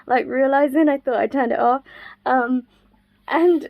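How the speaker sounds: ordinary talk, nothing marked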